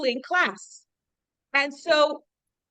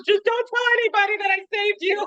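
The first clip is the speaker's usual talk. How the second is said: mock panicked plea; 8 semitones higher, 4 dB louder than usual. Joke punchline, rescuer begging Stalin.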